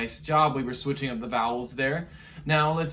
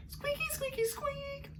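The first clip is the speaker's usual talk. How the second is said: very high, much softer squeak; tiny mouse squeak for fun; squeaky Artie, high and soft; 12 dB quieter than usual. Shown as a demonstration. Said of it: "let's copy after him".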